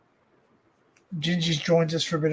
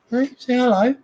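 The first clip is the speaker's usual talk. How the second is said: higher coaxing pet voice, louder; talking to the cat; 7 semitones higher, 6 dB louder than usual. Real, not acted. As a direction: coax the cat to say hello.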